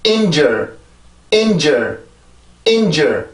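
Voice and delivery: 인저 repeated as a careful separate model word; pronunciation drill. Shown as a demonstration. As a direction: model 인저 for repeating, pausing after each. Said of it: "다시 한 번요".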